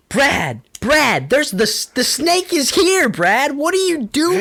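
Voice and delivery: mock frantic yell, pitched high; retold mom screaming. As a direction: imitate a mother hollering her son awake. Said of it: "screaming at me upstairs to get up".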